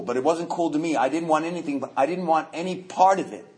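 normal talking voice

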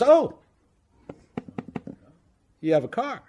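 high fake surprise, then dropping; mock-surprised God. God learning the drunk owns a car, mock surprise. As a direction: feign delight at hearing about the car. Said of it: "And God goes".

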